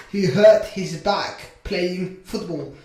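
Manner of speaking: slow, spaced-out model sentence; slow modelling. Shown as a demonstration. Teacher models a sentence for English learners, slowly. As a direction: take each word slowly for learners.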